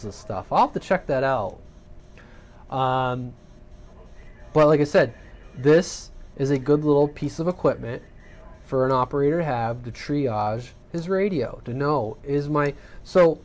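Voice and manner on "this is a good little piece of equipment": ordinary talk, nothing marked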